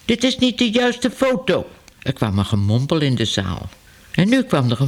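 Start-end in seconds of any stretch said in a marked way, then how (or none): 0.0-1.7 much higher, strained voice; Mr Smit calling out. A narrator's reading. Mr Smit protests to the hall, high and strained. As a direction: cry out flustered, pitched well up. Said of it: "tikte driftig met zijn stok en riep".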